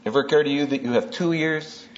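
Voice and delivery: usual speaking voice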